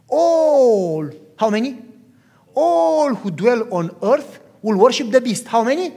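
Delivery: ringing, high-volume scripture emphasis; forceful preaching. Real, not acted. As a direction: hammer the verse at the congregation. Preacher hammers the word all.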